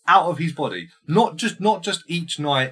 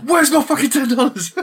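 high, loud comic yell; mock furious demand; 9 dB louder than usual. Riffing that she owes him money, shouting in mock rage. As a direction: shout it like an enraged debt collector. Joke continuing the strangling rage.

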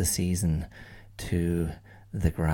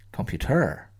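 computer with a higher, falling peak; stress on last syllable; 6 semitones higher, 3 dB louder than usual. Shown as a demonstration. Showing students how to stress each syllable. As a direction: lean on the end of computer. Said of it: "stress each syllable in turn".

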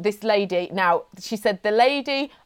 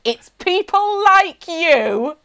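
slow, high and louder, a hammed-up sneer; sneering woman; 6 semitones higher, 5 dB louder, slower than usual. Woman in queue judging Mum, hammed-up sneer. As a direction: look down your nose at her. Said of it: "this woman went".

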